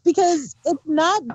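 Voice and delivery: ordinary talk, nothing marked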